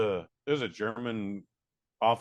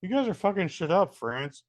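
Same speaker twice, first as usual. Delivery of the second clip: exasperated plea; 7 semitones higher than usual. Retold or imitated. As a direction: plead with them to knock it off. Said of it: "I'm like".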